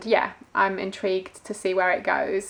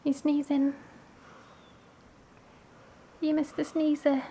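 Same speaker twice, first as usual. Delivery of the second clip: pet talk; 6 semitones higher, 4 dB quieter than usual. Real, not acted. Asking the pet about its sneezing, soft high baby talk. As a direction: tease the little sneezer fondly, up high.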